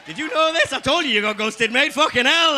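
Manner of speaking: roast her loudly; mock outrage. Real, not acted. Roasting audience member.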